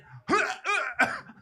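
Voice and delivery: short, high mock gags; imitated choking grunts. Comic acting out the shoving, imitated choking grunts. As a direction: mimic being choked in short bursts. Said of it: "they shove it in".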